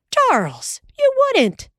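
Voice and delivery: shocked wife; wife scandalised by his teasing; exclaimed. Acted. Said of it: "Ma exclaimed".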